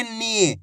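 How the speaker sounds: "नी" with the ई stretched; long drawn-out ī. Shown as a demonstration. Teacher giving learners an example, "नी" drawn out. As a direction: hold the vowel of "नी" long. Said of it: "बड़ी ई की मात्रा को खींच के पढ़ा जाता है".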